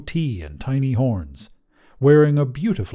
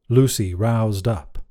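ordinary delivery

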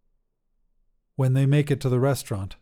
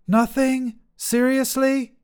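much higher, disbelieving; incredulous Mads; 13 semitones higher, 4 dB louder, slower than usual. Acted. Mads complaining from the kitchen, higher and incredulous. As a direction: voice Mads, incredulous at the empty fridge.

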